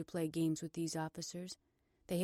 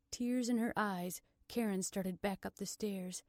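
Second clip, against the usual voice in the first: plain reading voice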